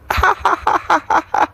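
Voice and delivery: a squealing high cackle; gossip's shrill-high giggle burst; high cackling laugh. Acted. Gleeful gossip about the fart.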